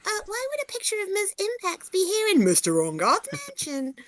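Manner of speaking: put-on high-pitched girl voice; character voice. Player retakes the girl's line in a high voice. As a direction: read the line as the young girl. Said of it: "Sorry, my voice went weird".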